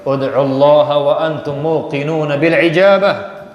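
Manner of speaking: slow, measured Arabic recitation; hadith recitation. Acted. (bacaan hadis perlahan dan khidmat)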